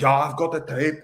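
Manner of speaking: put-on German accent. Klopp as a late-night shopping channel seller, mock German accent. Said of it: "in that German accent".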